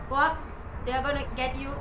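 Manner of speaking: loud, high urgent shout; panicked yell. Real, not acted. Gamer shouting at her character to climb, panicked yell.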